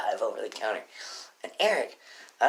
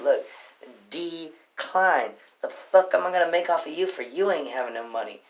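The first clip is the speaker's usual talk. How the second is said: loud, raised-pitch telling-off; retold exasperated rant; 5 semitones higher, 6 dB louder than usual. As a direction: spell it out angrily for a customer. Retold row with customer over card.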